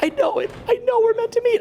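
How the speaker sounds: very high squeaky entranced voice; squeaky awed stranger. Retold or imitated. Stranger answering the mystic, squeaky high falsetto. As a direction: play a starstruck stranger in falsetto. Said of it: "they're like".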